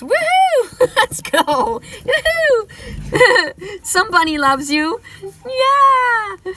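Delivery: high, loud whooping cheer; loud high celebration shouts; excited cheering. Real, not acted. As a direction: whoop with delight. Cheering at two cards drawn.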